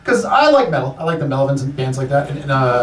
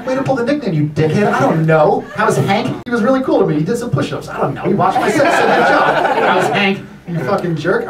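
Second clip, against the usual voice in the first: higher-pitched mimicking, hammed-up ribbing; sarcastic mockery; 7 semitones higher than usual. Comic needling a name-dropper, sarcastically mocking him. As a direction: jeer at the name-dropper in mock back-and-forth.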